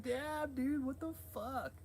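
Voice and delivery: high, slow disbelief; mock shocked. Vlogger retelling his reaction, high slow shocked. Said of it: "I'm like".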